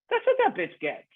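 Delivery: high mock mom voice, very high; mom's harsh quip. Son quoting his mom's dark joke, high and mocking. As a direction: squeak out mom's savage line.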